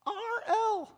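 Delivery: very high, loud squeaky name; real high-pitched voice. Retold or imitated. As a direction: pipe it out way up high. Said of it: "in this real high-pitched voice".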